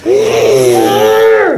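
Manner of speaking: low mock roar; dinosaur voice. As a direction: growl like a dinosaur. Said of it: "We gotta growl at him".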